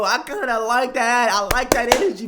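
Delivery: loud hyped-up hollering; hyped shout. Real, not acted. Cheering Ethan's TikTok to the viewers, loud hyped shouting. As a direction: yell your approval to the crowd.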